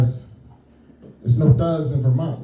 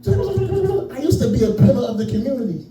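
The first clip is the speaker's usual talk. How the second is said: a lightly voiced pitiful fly, higher; buzzy housefly; 7 semitones higher than usual. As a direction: whine it like a sad little fly. Plays himself reborn as a fly.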